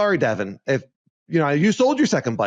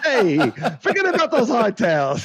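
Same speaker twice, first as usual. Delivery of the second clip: higher, hammed-up Italian "forget about"; mock Italian accent; 6 semitones higher than usual. Host teasing cohost, laughing mock Italian accent. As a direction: play a cartoon Italian, laughing. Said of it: "I promised Dennis I wouldn't do any more accents".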